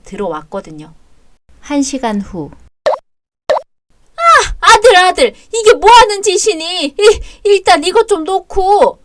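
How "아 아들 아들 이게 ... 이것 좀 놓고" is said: panicked pleading. Acted. Mother pleading while dragged out.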